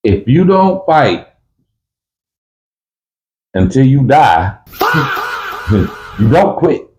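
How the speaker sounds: laughing while hammering the point; emphatic shout. Real, not acted. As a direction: drive the rule home with a shout. Emphatic prison rule, shouted.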